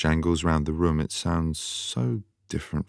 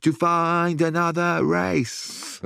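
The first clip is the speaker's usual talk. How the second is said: mock rave shout; 13 semitones higher than usual. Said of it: "I got up and I shouted".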